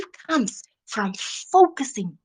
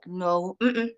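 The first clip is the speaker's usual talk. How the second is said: ordinary talk, nothing marked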